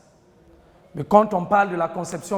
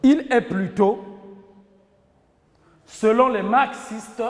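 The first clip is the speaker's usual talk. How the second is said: dictating. Shown as a demonstration. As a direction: dictate phrase by phrase, pausing between. Teacher dictating to class. (dictée lente, en détachant chaque groupe de mots)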